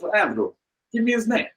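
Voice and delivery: high-pitched mock Russian insistence; Russian accent. Russian friend demands name and address, high imitated Russian accent. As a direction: mimic the Russian friend pressing for details. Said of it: "that same Russian friend".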